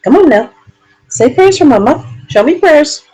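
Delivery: excited pet coaxing. Acted. Owner pushing Charlie to show prayers, excited pet voice. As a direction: rev the dog up for his trick.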